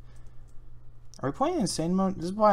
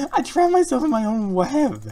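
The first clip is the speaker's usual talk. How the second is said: high, loud, slow despairing cry; exasperated wail; 12 semitones higher, 10 dB louder, slower than usual. Real, not acted. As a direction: wail it out in disbelief. Trapped himself, high and loud.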